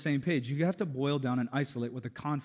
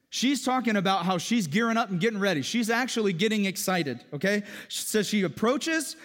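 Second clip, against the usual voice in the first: loud, high, fervent preacher voice; full-force preaching; 9 semitones higher, 7 dB louder than usual. Acted. Pastor retelling Song of Songs to congregation, fervent preaching. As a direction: preach it to the back row.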